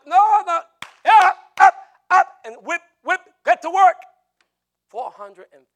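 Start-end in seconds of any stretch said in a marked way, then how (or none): 0.1-4.0 hammed-up yelling, much higher and louder; slave-driver shout. Acts slave driver refusing excuses.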